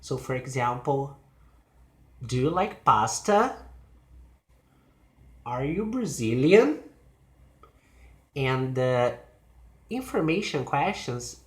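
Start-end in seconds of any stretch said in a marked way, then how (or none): 2.2-3.6 yes-or-no question climbing at "pasta"; rising question. Shown as a demonstration. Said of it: "they have a rising intonation". 5.4-6.8 yes-or-no question, "Brazilian" sweeping up; rising question. Shown as a demonstration. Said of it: "they have a rising intonation".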